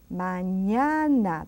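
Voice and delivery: Mañana said very slowly, ma-NYA-na; teacher's slow Mañana; slow model word. Shown as a demonstration. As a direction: draw Mañana out syllable by syllable. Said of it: "Repeat after me".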